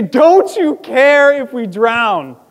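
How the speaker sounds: loud and slow, dragged out; accusing cry. Retold or imitated. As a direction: hurl the question at him. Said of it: "They say".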